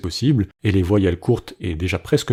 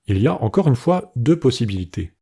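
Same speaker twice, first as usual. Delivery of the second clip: ordinary talk, nothing marked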